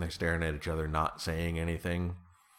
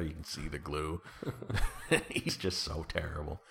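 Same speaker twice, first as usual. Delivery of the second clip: usual speaking voice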